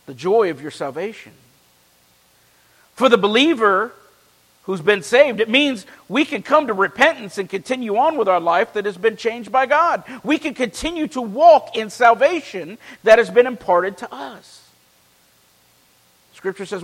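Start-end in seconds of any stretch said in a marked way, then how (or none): 3.0-14.7 raised, ringing pulpit voice; fervent preaching. Acted. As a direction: preach it out to the congregation. Preaching at full force.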